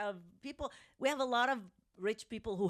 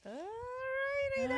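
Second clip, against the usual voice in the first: drawn-out sign-off; much slower than usual. Acted. Drawn-out sign-off, high and slow.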